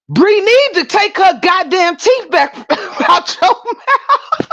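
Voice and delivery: slow and high, breaking into laughter; laughing through the quote. Real, not acted. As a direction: lose it mid-line. Quoting the lady's line.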